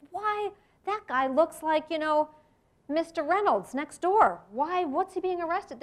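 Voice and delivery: mock surprise at a familiar face; puzzled surprise.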